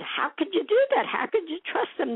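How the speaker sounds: high, agitated retold protest; riled up. Wife protesting to her husband, high and riled up. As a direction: pitch it up, alarmed at her husband. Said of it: "I got all riled up".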